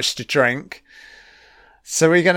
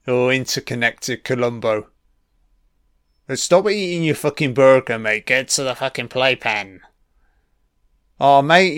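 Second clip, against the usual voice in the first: ordinary talk, nothing marked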